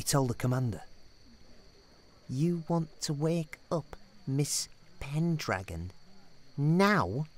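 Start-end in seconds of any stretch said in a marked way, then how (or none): none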